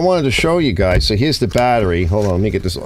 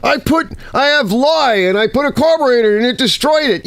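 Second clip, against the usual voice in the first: much higher, whiny complaining voice; mock the guy who ruined his carburetor; higher, indignant mock complaint; imitating a viewer; 12 semitones higher than usual. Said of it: "like, uh".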